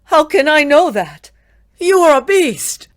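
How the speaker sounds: very high, very loud accusation; accusing. Acted. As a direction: hurl the accusation at the beast. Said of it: "accused Victor".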